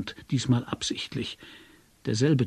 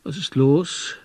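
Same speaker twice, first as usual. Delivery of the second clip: drowsy question; sleepy; 4 semitones higher, 4 dB louder, slower than usual. A narrator's reading. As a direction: feign being just woken. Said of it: "fragte ich schläfrig".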